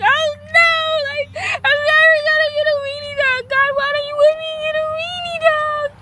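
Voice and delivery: high, loud whiny wail; mock wailing. Girl who lost the dog complaining to God, whiny wail. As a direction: whine to God like a heartbroken kid. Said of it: "I was, like, really sad".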